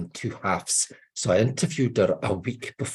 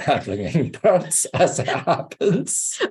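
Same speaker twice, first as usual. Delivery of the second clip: laughing; 7 semitones higher, 5 dB louder, slower than usual. Real, not acted. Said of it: "Oddly enough".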